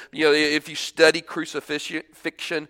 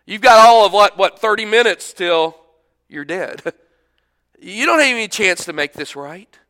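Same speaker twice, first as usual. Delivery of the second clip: louder sarcastic jeering; mocking crowd; 3 semitones higher, 7 dB louder than usual. Onlookers under the cross sneering at the criminal. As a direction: scoff at the dying man. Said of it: "That's their thought".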